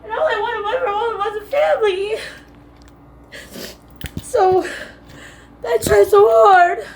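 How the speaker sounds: high, slow, tearful voice; real crying. Real, not acted. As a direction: plead through tears, stretching the words out. Crying about wanting a family.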